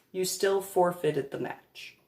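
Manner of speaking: ordinary talk, nothing marked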